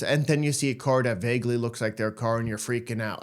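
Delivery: ordinary talk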